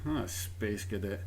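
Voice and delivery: soft, slow dismissive mutter. Acted. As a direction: mutter it under your breath.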